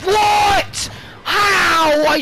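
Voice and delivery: high, loud shouts; outraged disbelief. Real, not acted. Gamer who shot and missed the kill, outraged shout. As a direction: yell each word in sheer disbelief.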